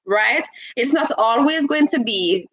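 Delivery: ordinary talk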